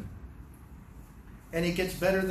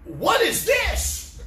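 very high, louder, slow shocked cry; outraged king; 12 semitones higher, 6 dB louder, much slower than usual. Retold or imitated. King finding Haman on Esther's bed, appalled. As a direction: stretch it out in appalled disbelief. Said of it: "basically he's saying".

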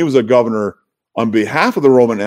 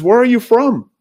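much higher, sharp demand from Pilate; 4 dB louder than usual. Acted. Preacher voicing Pilate demanding where Jesus is from, high. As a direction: fire the question up high and insistent.

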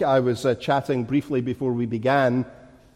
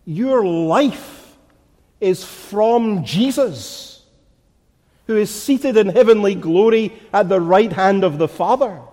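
emphatic, measured pulpit proclamation; forceful preaching; 5 semitones higher, 4 dB louder than usual. Real, not acted. Preacher telling congregation their life is from Jesus, forceful preaching. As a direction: press each phrase home, stretching the key words.

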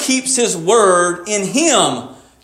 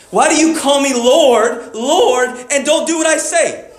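higher-pitched, pressing rebuke; cutting challenge; 5 semitones higher than usual. Retold or imitated. Preacher voicing Jesus confronting the crowd, raised and challenging. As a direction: drive the question home where it hurts. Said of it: "he hits it where it hurts".

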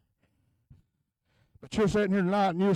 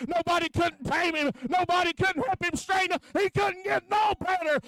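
higher, building to a shout; full-force preaching; 8 semitones higher than usual. Acted. Preacher answering the congregation's question, building to a shout. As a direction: answer the question with rising fire.

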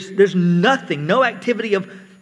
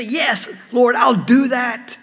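raised, emphatic pledge to the Lord; believer answering God; 7 semitones higher, 4 dB louder than usual. Acted. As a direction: act out a willing believer's fervent reply. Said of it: "and you say".

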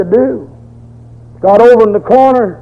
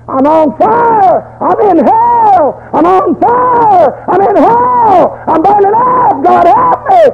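high, slow anguished cries building to a shout; screaming in agony; 11 semitones higher, slower than usual. Acted. Burned man screaming to the doctor in pain. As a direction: wail it like a man burning alive. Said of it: "this man was screaming".

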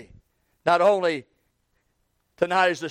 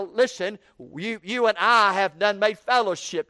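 normal talking voice

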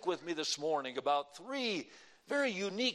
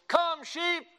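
high, loud sheep call; imitated pastor's yell; 11 semitones higher, 7 dB louder than usual. Dad mimicking the pastor to call sheep, loud high yell. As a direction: holler it with all your best mimicry. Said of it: "with my best imitation of his voice, I yelled".

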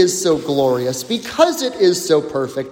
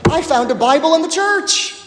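surprised finder; 8 semitones higher, faster than usual. Retold or imitated. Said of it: "and they're like".

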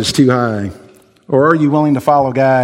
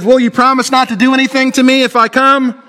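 put-on much higher pleading girl voice; anxious girl bargaining; Jill pleading; 9 semitones higher than usual. A narrator's reading. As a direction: beg the lion for safety. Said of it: "She said".

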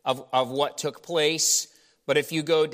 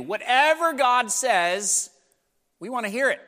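voiced high and pleading, as the eager survivors; eager townspeople; 8 semitones higher, 4 dB louder than usual. Preacher voicing the survivors begging Jeremiah, eager and high. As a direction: overeager promise to obey God. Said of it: "This is what they said".

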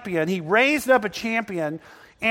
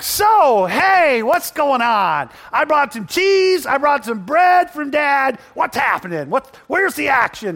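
put-on higher, louder, excited shepherd boy; eager young David; 6 semitones higher, 6 dB louder than usual. Young David arriving at the battle with cheese and bread. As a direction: bounce in like a cheerful kid.

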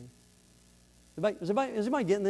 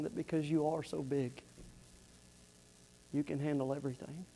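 humble prayer; 2 semitones lower, 8 dB quieter than usual. Acted.

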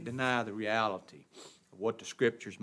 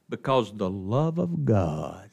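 low, slow, weighted delivery; slow and hushed from the pulpit; solemn scripture reading; 5 semitones lower, 5 dB louder, slower than usual. Real, not acted. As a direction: let each word of scripture sink in.

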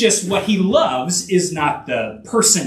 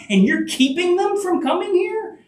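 indignant; 11 semitones higher than usual. Retold or imitated. Voicing Jesus's anger at merchants.

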